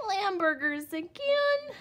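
put-on high, loud childish whine; whined.

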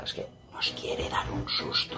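soft secretive whisper. Real, not acted. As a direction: whisper it so nobody hears. Secret plan before the prank.

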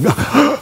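very high startled gasp; sharp gasp. Retold or imitated. Father lunges to save his kids, sharp alarmed gasp.

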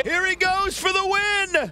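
excited call. Real, not acted. Commentator hyping the winning move to viewers. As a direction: sell the big finish. Finish approaching.

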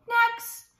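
high, loud sing-out call; fox's yell. Acted. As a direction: yell for the next one in line. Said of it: "They heard Foxy yell".

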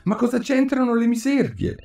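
amazed Alice. A narrator's reading.

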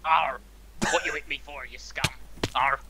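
mock pirate talk, hammed up; pirate accent. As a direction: play a rowdy pirate. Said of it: "I'll get used to the accent eventually".

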